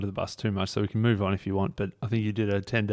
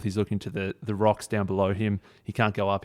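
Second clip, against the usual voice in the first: ordinary talk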